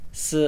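"s" with a sharp falling fourth tone. Shown as a demonstration. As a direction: drop hard on "s". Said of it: "meaning four".

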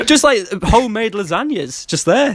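high-pitched, laughing through the words; real laughing. Real, not acted. Friend recalls the free buffet to his mate, laughing, high-pitched. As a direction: barely get it out for laughing.

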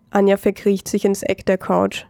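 plain reading voice

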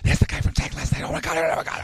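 hushed whisper of gossiping strangers; imitated whispering shoppers. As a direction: whisper it behind your hand. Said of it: "people are whispering".

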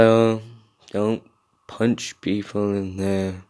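slow, dreary, mumbled instructor voice; drowny depressed voice. Retold or imitated. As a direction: drone it out like a man who hates his job. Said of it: "his drowny little voice".

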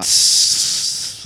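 unvoiced creeper hiss. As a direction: hiss like a creeper about to blow. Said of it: "Do a Minecraft creeper".

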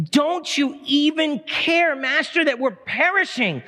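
high, slow, drawn-out cry for help; panicked plea. Acted. Preacher as disciples waking sleeping Jesus, panicked plea. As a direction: act out a desperate, stretched plea.

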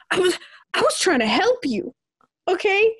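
high, exasperated protest; I was only trying to help. Retold or imitated. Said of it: "And I'm over here like".

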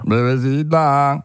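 lazy mock reggaeton croon. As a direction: drag out bebecita like the reggaeton singer.

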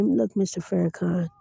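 ordinary talk

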